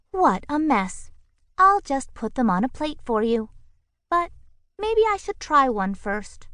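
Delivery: a prim bird tidying up; fussy bird voice. A narrator's reading. Bird character voice.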